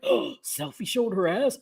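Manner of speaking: hushed mock-scandal voice; mocking gossip. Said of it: "They were like".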